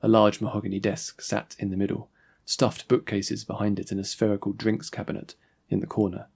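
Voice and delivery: plain reading voice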